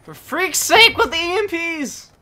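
drawn-out furious gamer yell; frustrated yell. Real, not acted. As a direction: blow up at the screen. Raging at game.

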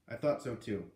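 usual speaking voice

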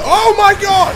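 very high, loud yell; panicked shout. Real, not acted. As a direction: scream it in sudden alarm. Sudden danger in game.

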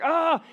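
a sudden, high, loud cry of frustration; frustrated cry. Retold or imitated. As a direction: yelp like a fed-up cartoon kid. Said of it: "And he's like".

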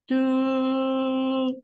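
one long, unwavering held note; straight-line horn blast. Shown as a demonstration. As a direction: imitate the tekiah as a single sustained tone. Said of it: "straight line connection".

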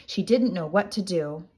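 usual speaking voice

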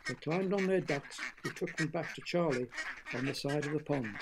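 plain reading voice

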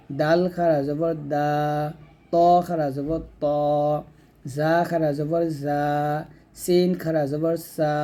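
measured chanted letter drill, final syllables like "মা", "বা", "লা" held long; one-alif drawn-out vowel. Shown as a demonstration. Teacher reads the small letters likewise, drawn-out "মা", "বা", "সা". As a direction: spell each letter, then stretch its sound, as in "মা", "দা", "না". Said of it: "একইভাবে".

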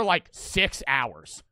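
ordinary delivery

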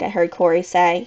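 plain reading voice

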